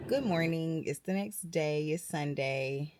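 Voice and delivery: soft, slow, just-woke-up voice; sleepy morning voice. Real, not acted. Vlogger greeting viewers right after waking up, soft and slow. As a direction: murmur it softly, slow and drowsy.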